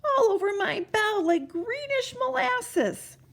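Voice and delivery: put-on high, slow, worried old bell ringer; a fretful little bell ringer staring up; alarmed bell ringer. A narrator's reading.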